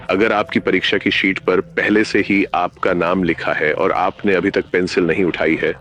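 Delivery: ordinary delivery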